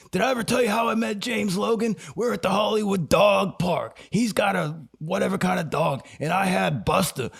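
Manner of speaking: high, excited storytelling buddy; Stone Man voice. Retold or imitated. Stone Man telling his friend the dog park story, high and eager. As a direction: tell it like a thrilled old friend. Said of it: "I'm using my Stone Man voice".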